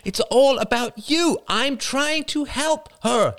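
high, heated mother pleading; rising, near-shouting defence. Acted. Mother defending herself hotly against her son.

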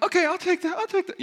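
high, overly bright fake-cheerful okay; fake agreeable. Taking the wife's leftover donut, high fake-cheerful agreement. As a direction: pretend to be fine with it, voice going up. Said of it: "when the voice goes up a little bit".

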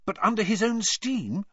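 an eager, high-pitched question. A narrator's reading. Clever girl eagerly quizzes her teacher, excited and high. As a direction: jump in keen to solve the puzzle. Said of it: "said Hermione eagerly".

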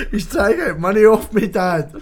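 loud, high imitation of prank victim; high indignant complaint to Dad; high mock outrage. Imitating the man in his van, high outraged voice. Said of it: "I can fully imagine these guys".

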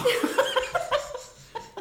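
high, breathy burst of laughter. Real, not acted. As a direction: crack up laughing. Laughs at yawning joke.

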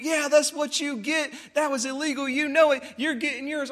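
high-pitched mock heckling; imitated gloating rant. Driver yelling out the window at the pulled-over motorcyclist, gloating. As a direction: crow it at someone who got caught. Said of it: "he's just going off on this guy".

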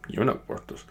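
very soft, whispered mumble; hushed, offended little comeback; sulky kid. Acted. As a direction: mumble it under your breath, hurt. Sulky kid kicking a rock.

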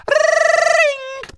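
very high, loud ringing phone; phone ring imitation.

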